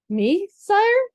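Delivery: high, timid donkey voice asking back; a meek little donkey squeaking up; donkey's voice. Acted. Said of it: "came the donkey's voice".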